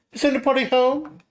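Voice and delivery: calling out. Acted. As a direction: call into the empty hall. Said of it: "I raised my voice".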